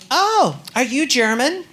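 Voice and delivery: slow, puzzled lady passenger; drawn-out curious tourist voice; slow questioning lady. Retold or imitated.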